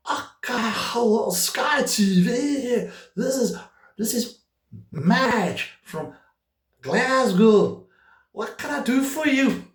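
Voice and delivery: high-pitched, hammed-up Scottish call-centre woman; awful Scottish accent. Sky phone operator greets a caller from Glasgow, awful Scottish accent. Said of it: "That's a really awful Scottish accent".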